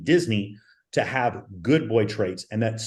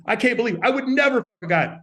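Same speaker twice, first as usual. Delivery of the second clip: higher, hammed-up protest on "never"; mock scream; 9 semitones higher than usual. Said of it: "a woman screaming to you".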